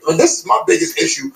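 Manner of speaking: normal talking voice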